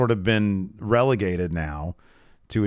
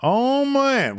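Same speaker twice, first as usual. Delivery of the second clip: high, loud gleeful oh man; excited oh man; 3 dB louder than usual. Real, not acted. To co-host, excited about the finish. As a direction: pop with delight at the memory.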